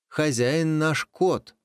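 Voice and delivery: high, slow, proud; proud announcement. Acted. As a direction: declare it grandly. Proud reply to wolf.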